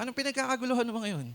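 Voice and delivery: quick, high, curious question; a young man asking what the fuss is about; curious David. Acted. (mabilis at mataas na tanong ni David)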